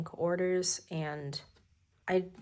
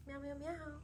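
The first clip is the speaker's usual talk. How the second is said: soft, high meows for the cat; 9 semitones higher, 14 dB quieter than usual. Real, not acted. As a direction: meow back softly up high at the cat. Meowing at the cat.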